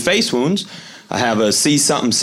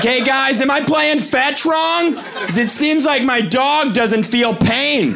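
puzzled dog owner; 12 semitones higher, slower than usual. Retold or imitated. Imagined dog-owner question.

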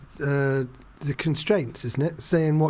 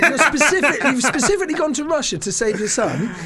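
higher, louder, disbelieving scolding; exasperated mock retort; 8 semitones higher, 4 dB louder than usual. Viewer scolding McClane through the screen, mock outraged and higher. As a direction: tell the hero off in mock outrage. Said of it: "you're just like going".

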